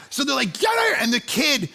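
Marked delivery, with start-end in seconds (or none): 0.5-1.0 very high, startled yelp; acted-out squeal of alarm; panicked cry. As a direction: imitate a family scrambling to flee. Retold family fleeing in panic.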